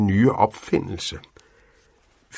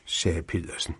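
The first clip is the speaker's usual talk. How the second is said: plain reading voice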